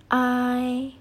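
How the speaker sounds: "ai" held long, high and level; pronunciation demo. Shown as a demonstration. Teacher asks students to follow along, sustained vowel demo. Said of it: "cara pengucapannya".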